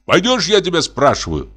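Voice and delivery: high, insistent demand; angry cry. A narrator's reading. Hunter demands that the forester come along, angry cry. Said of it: "крикнул охотник, злобно тараща глаза".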